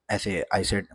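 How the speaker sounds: usual speaking voice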